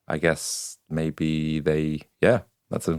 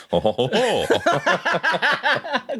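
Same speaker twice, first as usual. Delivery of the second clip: laughing; much slower than usual. Retold or imitated. Laughing [name] French impression.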